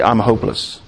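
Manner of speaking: low, subdued voice of despair; acted despondency, broken by long pauses; hopeless. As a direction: sink low, as if giving up. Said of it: "where we say".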